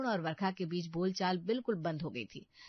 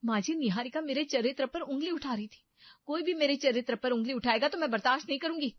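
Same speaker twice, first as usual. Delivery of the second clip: higher, heated self-defence; indignant protest; 6 semitones higher, 6 dB louder than usual. Acted. Daughter-in-law defends her character to mother-in-law, indignant protest. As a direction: stand your ground firmly.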